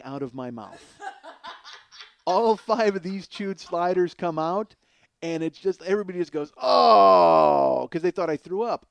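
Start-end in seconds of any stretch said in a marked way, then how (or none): 1.0-2.0 breathy laugh. Real, not acted. Laugh after spitting out food. 6.6-7.9 loud drawn-out groan of disgust. Retold or imitated. Crowd groans at spit sliders.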